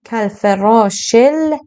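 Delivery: کالفراشل with the ش run into ل, slow; slow chanted linking; joined shin to lam. Shown as a demonstration. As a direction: link ش straight into ل.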